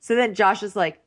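ordinary talk

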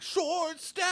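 very high, drawn-out mock war cry; mock battle cry. Possessed Viking contestant ready to fight, high mock battle cry. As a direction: squeal out a comic Viking rallying call.

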